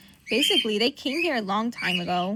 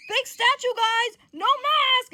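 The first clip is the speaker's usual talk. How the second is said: loud, high, punchy announcement; insistent; 13 semitones higher, 8 dB louder, slower than usual. Acted. Johnny pointing the statue out to the guys, insistent yell.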